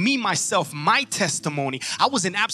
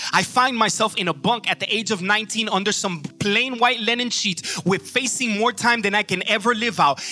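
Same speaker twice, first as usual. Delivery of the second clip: usual speaking voice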